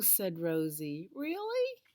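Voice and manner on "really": very high, delighted question; a rising gasp of joy; surprised. A narrator's reading. Rosie hearing she is the prize rose, surprised.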